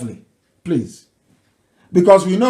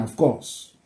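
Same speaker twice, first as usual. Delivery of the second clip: ordinary talk, nothing marked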